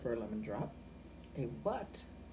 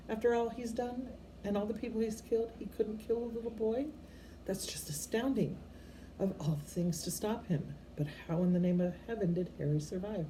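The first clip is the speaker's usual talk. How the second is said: higher-pitched stern witch in disbelief; astonished McGonagall; 7 semitones higher than usual. Acted. McGonagall marvelling to Dumbledore about Harry, higher and astonished. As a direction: let disbelief lift her voice high.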